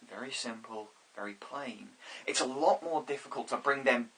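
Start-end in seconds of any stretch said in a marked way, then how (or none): 0.1-1.9 understated. Shown as a demonstration. Describing understated actors, voice drops.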